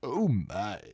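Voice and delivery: low, swooping catchphrase impression; Sulu. Capping the Sulu bit with a loud, low impression. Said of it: "I say that like Sulu".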